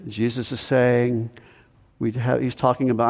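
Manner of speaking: ordinary talk, nothing marked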